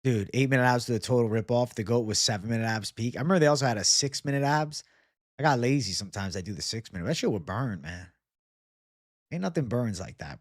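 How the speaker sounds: ordinary talk, nothing marked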